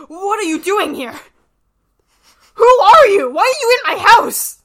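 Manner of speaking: high, loud, alarmed shouting. Acted. Homeowner yelling at strangers in his house, alarmed shout. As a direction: yell at the intruders in panic.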